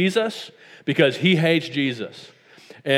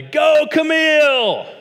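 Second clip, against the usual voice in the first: high, loud proud-dad shout; dad cheering his daughter's name at a recital; 13 semitones higher, 5 dB louder, slower than usual. Retold or imitated. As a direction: cheer your daughter on across the hall. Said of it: "I said really loudly".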